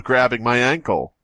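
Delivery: ordinary talk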